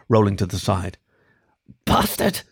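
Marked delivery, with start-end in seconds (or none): 1.8-2.4 sputtered. Acted. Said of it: "sputtered the fennec".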